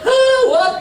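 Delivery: very high, loud sing-song "huh, what?"; mocking the shopkeeper. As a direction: mimic the rude shopkeeper's snap. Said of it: "Tadi nyolot".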